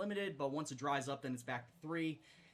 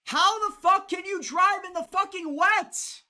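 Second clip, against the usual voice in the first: screamed, much higher and louder, slowed; raging shout; 13 semitones higher, 11 dB louder, slower than usual. Real, not acted. Racer screaming at the game about the rain, raging. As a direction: yell it at the screen.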